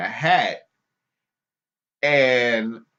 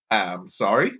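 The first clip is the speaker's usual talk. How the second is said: ordinary delivery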